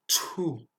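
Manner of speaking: "Chu" as "tchou" with a strong puff; breathy burst before ou in "Chu"; aspirated CH. Shown as a demonstration. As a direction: push air out after the tch of "Chu". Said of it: "suivi d'une expiration".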